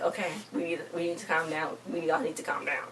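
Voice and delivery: hushed, calming voice for the group. Retold or imitated. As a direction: settle everyone down quietly. Abby calming the argument.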